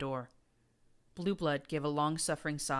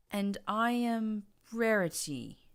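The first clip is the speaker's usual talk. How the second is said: forced, trembling line; 6 semitones higher, slower than usual. A narrator's reading. Forced to repeat a name.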